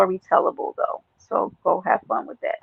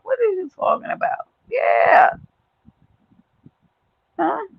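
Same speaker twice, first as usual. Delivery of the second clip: squeaky high what are you talking about; hammed-up high-pitched huh; mock disbelief; 12 semitones higher than usual. Streamer mocks a doubter in chat, high disbelief.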